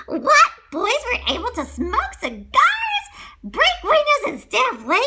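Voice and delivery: high, loud startled questions; shocked disbelief. A narrator's reading. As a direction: gasp out the disbelief. Shocked at Pleasure Island.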